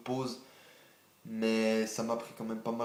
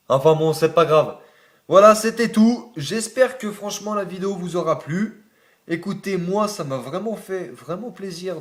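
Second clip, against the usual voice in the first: upbeat sign-off; 6 semitones higher, 8 dB louder than usual. Real, not acted. Cheerful sign-off after the run.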